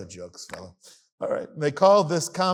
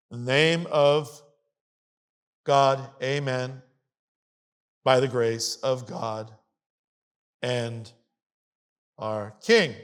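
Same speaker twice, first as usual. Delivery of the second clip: slow, measured reading with long pauses; solemn reading; 3 semitones lower, slower than usual. Acted. Lecturer reading the compact to a class, slow and solemn. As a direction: read it out deliberately, phrase by phrase, sinking lower. Reads compact aloud, phrase by phrase.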